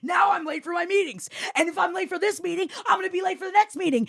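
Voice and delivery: high, fast re-enacted tirade; screaming. Late worker ranting at the blocked exit, screaming. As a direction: race through the grievance at a shouted pitch. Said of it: "As I'm screaming at the".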